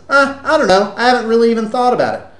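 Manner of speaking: mock defensive dunno; acted cluelessness. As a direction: play the guy who has no idea. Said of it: "Or he says".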